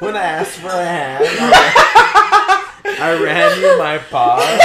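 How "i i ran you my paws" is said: laughing. Real, not acted. Echoing punchline, laughing.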